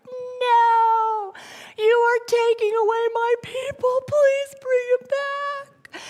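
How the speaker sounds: put-on high, pleading little child, stretching the last words slowly; imitated distraught little daughter; upset toddler voice. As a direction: beg like a small girl being taken from her people. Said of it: "She was just like".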